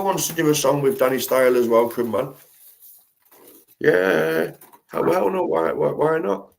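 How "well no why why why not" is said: ordinary talk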